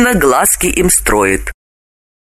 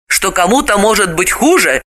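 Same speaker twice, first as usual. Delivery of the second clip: ordinary delivery, nothing marked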